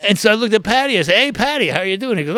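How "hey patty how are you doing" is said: high, loud retold greeting; upbeat imitated hello; hailing a friend. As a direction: act out calling hello to a pal.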